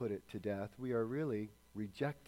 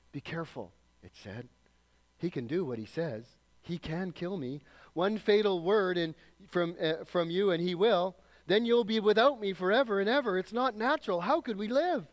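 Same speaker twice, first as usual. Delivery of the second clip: high, loud, wheedling lizard voice; chattering lizard; 8 semitones higher, 9 dB louder than usual. Acted. Lizard begging the ghost not to let it die, high chattering plea. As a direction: let the lizard plead louder and higher as it panics. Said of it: "the lizard began chattering ... so loud".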